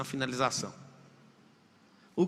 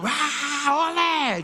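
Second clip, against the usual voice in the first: imitating a roaring crowd, high and raised; multidão gritando; 9 semitones higher, 4 dB louder, much slower than usual. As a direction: crowd roar rising into a chant. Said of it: "E todo mundo gritando". (imitando a gritaria da torcida, bem agudo)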